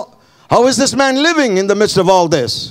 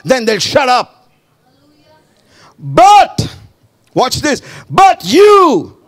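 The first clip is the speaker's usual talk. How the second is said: high, loud bursts on BUT; fired-up, emphatic interjections; impassioned preaching; 8 semitones higher, 4 dB louder than usual. Shown as a demonstration. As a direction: coach a defiant retort from the pulpit. Preacher coaching a defiant retort.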